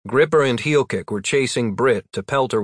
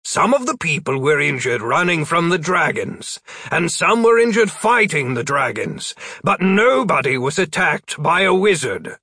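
firm voice; 5 semitones higher than usual. A narrator's reading. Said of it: "trying out his being firm voice".

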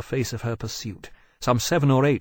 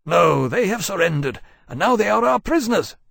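high, raised captain's voice; restraining captain; 9 semitones higher, 6 dB louder than usual. A narrator's reading. Captain stopping his dragon killing prisoners, insistent. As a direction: firmly overrule her, voice pitched up.

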